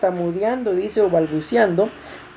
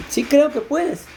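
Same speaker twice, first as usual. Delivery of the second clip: pleading believer; 7 semitones higher, slower than usual. Acted. Preacher re-voices the man's answer.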